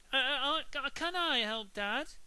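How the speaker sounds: high, stammering goody-goody; eager little boy. Acted. Peter cutting in on Henry to help Dad. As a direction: butt in sounding desperate to please.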